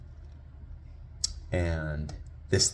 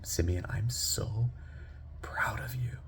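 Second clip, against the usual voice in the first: soft, slow, near-whispered pride; tender reassurance; 4 semitones higher, 8 dB quieter, slower than usual. Retold or imitated. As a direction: kneel down and gently reassure your child. Kneels to reassure teary son.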